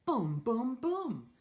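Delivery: thunder booms. Acted. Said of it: "Mister Brown makes thunder".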